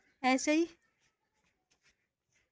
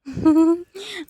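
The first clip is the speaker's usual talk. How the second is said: laughing; 4 semitones higher, 8 dB louder than usual. Real, not acted. Laughing with calves.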